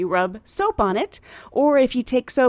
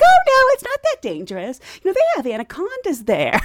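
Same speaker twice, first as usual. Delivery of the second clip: excited reassurance; 9 semitones higher, 5 dB louder than usual. Retold or imitated. Excited reply to stranger.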